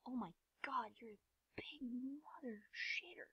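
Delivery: whispered, slow curse; hushed exclamation. Real, not acted. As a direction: whisper it slowly under your breath. Muttered reaction to the game.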